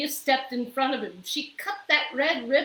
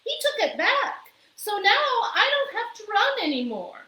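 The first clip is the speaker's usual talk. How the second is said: high, bright-eyed character voice; the maid proudly explaining to the mayor, high and loud; Amelia Bedelia; 11 semitones higher, 4 dB louder, faster than usual. A narrator's reading. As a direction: say it high and cheerful. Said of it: "said Amelia Bedelia".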